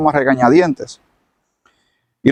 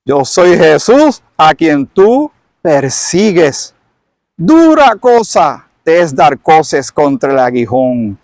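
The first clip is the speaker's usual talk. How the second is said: slow, solemn, weighty rebuke; Jesus solemnly rebukes Saulo, very slow; 3 dB louder, slower than usual. Acted. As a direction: let each word fall slowly. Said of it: "Y le dijo".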